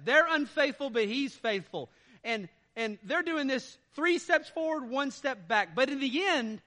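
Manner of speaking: loud, driving sermon voice; preaching at full force. Acted. Preacher rousing the congregation about God's power, preaching hard. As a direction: hammer home that God does it all. Preacher retelling Israel's story.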